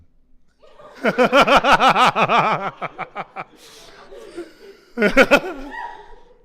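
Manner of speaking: loud, high, prolonged laughter; real helpless laughing fit; long laugh. Real, not acted. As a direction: laugh hard and let it run on. Game master cracks up mid-scene.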